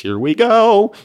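high, loud, held-out call; loud interjection. Real, not acted. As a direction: break out with a drawn-out shout on GO. Sudden sung-out aside while reading.

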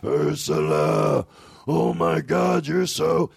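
goofy voice to make the dog go crazy; silly dog voice. Retold or imitated. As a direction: stretch out the dog's name in a goofy voice. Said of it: "here's what it sounded like".

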